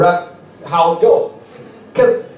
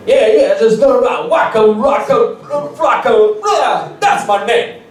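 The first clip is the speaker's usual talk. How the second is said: higher, mock rapper voice bragging about his name; 6 semitones higher than usual. Comic imitates a rapper naming himself, high mock voice. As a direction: puff up like a proud rapper in the studio. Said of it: "and he was like".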